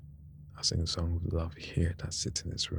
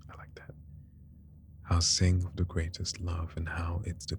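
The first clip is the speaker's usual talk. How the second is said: soft, slow poetic whisper; whispered poem; 6 dB quieter, slower than usual. Acted. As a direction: recite it softly like a wedding poem.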